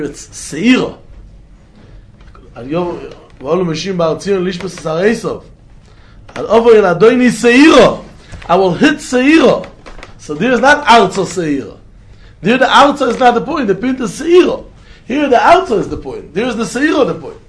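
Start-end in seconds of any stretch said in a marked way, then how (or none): 6.4-17.4 raised, high, forceful explanation; emphatic teaching. Real, not acted. Teacher hammering the point to students, emphatic and worked up. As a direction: drive the contrast home, loud and high. Teacher drives home the key distinction.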